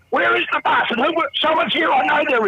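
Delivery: high, frantic demand; frantic accusation. Acted. Jealous husband bursting into his flat, high and frantic. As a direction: storm in hunting for the lover.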